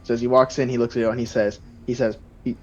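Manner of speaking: ordinary talk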